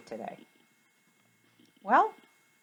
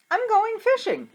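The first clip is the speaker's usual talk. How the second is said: eager announcement; 5 dB louder than usual. Acted. Peter announcing to the disciples.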